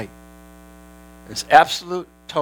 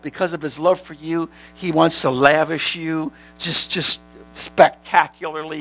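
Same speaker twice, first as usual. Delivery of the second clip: ordinary talk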